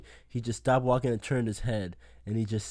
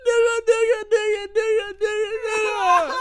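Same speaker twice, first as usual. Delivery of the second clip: high, loud repeated calling, louder each time; 7 dB louder than usual. Retold or imitated. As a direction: mimic her shouting it again and again. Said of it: "she started saying it louder and more repeatedly".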